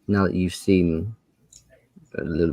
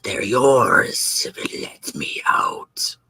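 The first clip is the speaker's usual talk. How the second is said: hissed, whispered creature bargaining; a trapped dragon tempting them with riches; whispery pleading dragon; 10 semitones higher, 4 dB quieter than usual. Acted.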